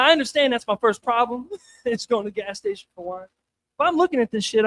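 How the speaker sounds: normal talking voice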